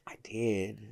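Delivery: hushed whisper. Real, not acted. Hesitant confession about oral sex.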